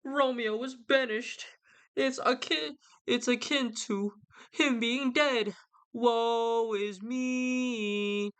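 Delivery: high, slow, theatrical lament; melodramatic Romeo. Retold or imitated. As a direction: ham up Romeo's lovesick self-pity. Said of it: "he's like".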